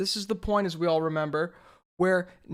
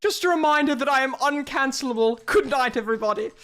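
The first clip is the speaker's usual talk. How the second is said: higher, louder mock-grand sign-off; pompous John voice; 7 semitones higher, 4 dB louder than usual. Streamer mocks John's smug farewell in a pompous voice. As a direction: ham up a smug villain. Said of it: "the most pompous accent".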